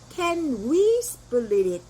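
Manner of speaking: Can we split it drawn out very slowly with we stressed; slow model question to the waiter; very slow demo. Shown as a demonstration. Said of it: "이렇게 말하면 돼요".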